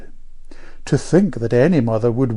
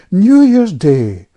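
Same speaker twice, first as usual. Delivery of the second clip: slow, loud, raised pulpit tone; soulful vicar; 7 semitones higher, 10 dB louder, slower than usual. A narrator's reading. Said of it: "turned up his eyes soulfully".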